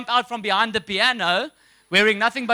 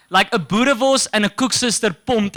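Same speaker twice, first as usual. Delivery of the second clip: ordinary talk, nothing marked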